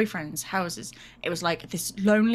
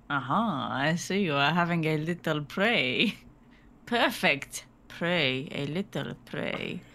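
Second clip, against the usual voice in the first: put-on Spanish accent; 5 semitones lower, 3 dB quieter, slower than usual. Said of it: "he went a bit Spanish there".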